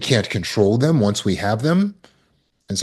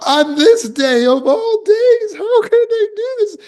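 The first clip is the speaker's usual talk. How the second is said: mock indignant whine; 5 dB louder than usual. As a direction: wail like the world has wronged you. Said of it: "the should statements".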